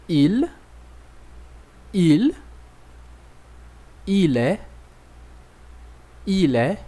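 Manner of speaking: each word twice, with pauses to repeat; patient teacher drill; French repetition drill. Shown as a demonstration. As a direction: model for the class to echo. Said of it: "vamos repetir comigo".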